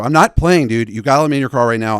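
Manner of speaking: loud, fast desperate pleading; panicked plea to a stranger for help; frantic plea. Retold or imitated. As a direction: urge him to let you in, fast and loud. Begging stranger to let him in.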